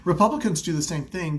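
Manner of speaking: ordinary delivery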